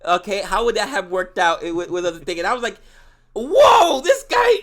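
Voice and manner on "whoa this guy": much higher and louder, astonished; amazed whoa. Real, not acted. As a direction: throw your voice up in disbelief. Reacting to scene.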